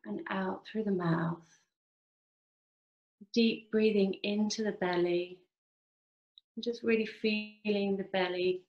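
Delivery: slow meditation voice with long pauses; measured, drawn-out phrases, leaving space to breathe; guided meditation. Acted. Clinic worker leads online group through body relaxation, guided meditation.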